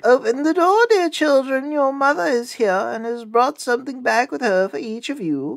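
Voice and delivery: high, put-on sweet mother voice; wolf posing as mother in falsetto; softened wolf voice. A narrator's reading. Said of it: "made his voice soft with it".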